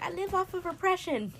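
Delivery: high, loud jokey declaration; joking confession. Retold or imitated. Joking to friends about repression, high and loud. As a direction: announce it like a punchline. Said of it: "I'm like".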